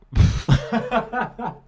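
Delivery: high laugh. Real, not acted. Laughing at underwater voice attempt.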